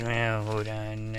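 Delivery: ordinary talk, nothing marked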